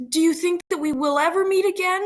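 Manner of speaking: high, quick, rising question; wondering Juliet. Acted. Juliet asks whether they will meet, high questioning. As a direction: ask it high and fast, climbing at the end.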